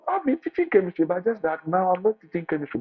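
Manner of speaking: retelling a wild high reply to the agency; excited retort. Retold or imitated. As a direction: blurt it out with wild eagerness. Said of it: "I was wild".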